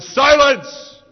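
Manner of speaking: God's law silencing a babbling defendant with a roar; thundering shout. Acted. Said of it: "And God's law shouted".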